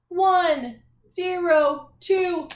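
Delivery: slow, drawn-out numbers, each one stretched; announcing a room number, slow and loud; slow room number call. Shown as a demonstration. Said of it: "when I say your class's room number".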